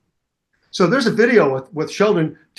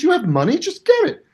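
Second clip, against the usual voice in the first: wife urging; 10 semitones higher, faster than usual. Retold or imitated. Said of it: "And she goes".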